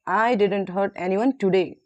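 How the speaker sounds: "today" set apart with a falling tone; stress on TODAY. Shown as a demonstration. Teacher models highlighted word for class, stress on "today". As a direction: save the weight for "today". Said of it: "we have to stress the highlighted words".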